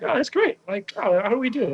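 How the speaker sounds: high-pitched mock excited reaction; tipsy delight. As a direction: re-enact a tipsy, eager response to news. Retelling tipsy reaction to results.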